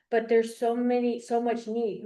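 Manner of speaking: normal talking voice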